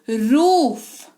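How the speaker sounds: "रूफ" with the ऊ held very long; slow long "roof". Shown as a demonstration. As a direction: stretch the ऊ in "रूफ". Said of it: "ठहराव आएगा". ("रूफ" में ऊ पर ठहराव)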